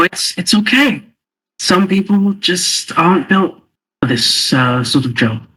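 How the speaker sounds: condescending taunt. Acted. Player taunts the goblin in character.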